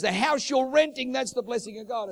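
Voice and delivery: high, loud retold insistence; emphatic insistence. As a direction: press the point as if correcting someone. Pastor repeats himself, insisting.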